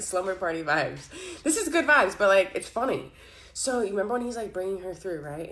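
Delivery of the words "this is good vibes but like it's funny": loud, higher, still giggly; riding the laugh, delighted; amused. Real, not acted. Reader telling viewers it's funny, amused and loud.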